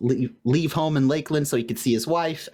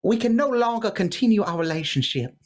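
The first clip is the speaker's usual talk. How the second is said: put-on Southern accent; 7 semitones higher than usual. Sweetheart breaking up by letter, in a Southern accent. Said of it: "I made this Southern".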